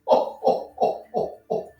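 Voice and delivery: gentle Santa chuckle; Santa laugh. Acted. Santa laughs after beard line.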